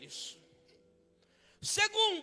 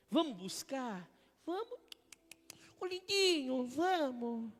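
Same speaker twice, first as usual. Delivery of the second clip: higher, sugary coaxing voice; mock coaxing; 5 semitones higher, 3 dB louder, slower than usual. God gently coaxing the lazy believer, mock sugary. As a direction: sweet-talk him into coming along. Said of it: "Deus só vai falar assim".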